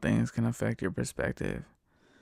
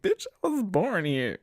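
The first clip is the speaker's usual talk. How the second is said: mock retort; 8 semitones higher than usual.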